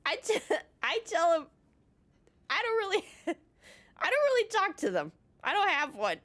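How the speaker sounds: high-pitched, breaking up with laughter; cracking up mid-story, voice shooting up; laughing. Real, not acted. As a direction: try to get it out through giggles. Cracks up retelling her answer.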